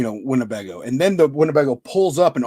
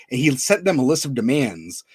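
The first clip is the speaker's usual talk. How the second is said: ordinary delivery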